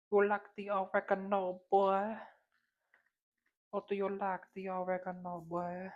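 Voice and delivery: high-pitched teasing voice; high playful crooning voice. Acted. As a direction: tease the seasoning in a high voice.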